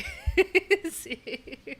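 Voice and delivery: high, loud embarrassed laugh; burst of laughter. Real, not acted. Finding her face on a stranger's page, a loud laugh. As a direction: laugh it off, caught off guard.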